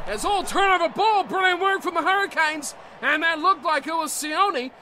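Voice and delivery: loud, high commentary building to a shout; excited play-by-play. Acted. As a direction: call the break and the try at full pitch. Live call of breakaway try.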